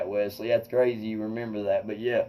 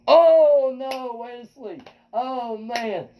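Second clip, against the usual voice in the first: mock dismay; 10 semitones higher, 4 dB louder, much slower than usual. Mock dismay at friend's prompt.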